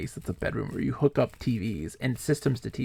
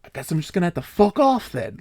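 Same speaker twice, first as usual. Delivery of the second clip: mock huffy retort, louder; imitating angry guy; 4 semitones higher, 6 dB louder than usual. Retelling the mad guy in VR, mock huffy. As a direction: ham up a sulking storm-off. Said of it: "the guy got so mad at me".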